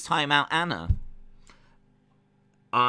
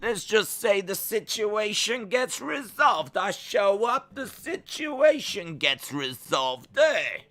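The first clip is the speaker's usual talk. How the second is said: high, hammed-up Italian wiseguy accent; put-on Italian accent; 8 semitones higher than usual. Streamer imitating an Italian wrestler for the chat, put-on Italian accent. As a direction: lay on a sing-song Italian accent, drawn out. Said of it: "Daisy's Italian accent sound more like Russian".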